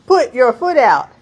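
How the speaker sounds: loud, high command; instructor shouting over wind. Retold or imitated. Instructor ordering her at the open door. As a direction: bark it over engine noise. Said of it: "He is like".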